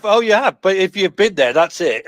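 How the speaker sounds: normal talking voice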